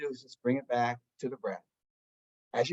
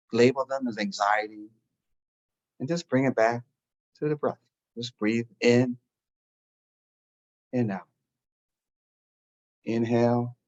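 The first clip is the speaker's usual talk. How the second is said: guided meditation; 2 semitones lower than usual. Acted. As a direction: talk them gently into stillness. Leads a meditation.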